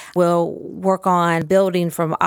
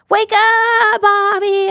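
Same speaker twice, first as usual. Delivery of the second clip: much higher, bright and bouncy wake up; big exciting wake up; 6 dB louder, much slower than usual. Shown as a demonstration. Waking the toy person for the child, much higher and excited. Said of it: "we're having a party".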